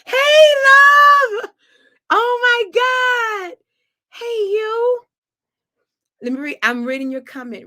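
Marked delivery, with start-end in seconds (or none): 0.0-5.0 high, loud gushing hello; excited greeting. Real, not acted. Singer thrilled to see Kim in comments, high loud greeting. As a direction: greet her like a long-lost friend.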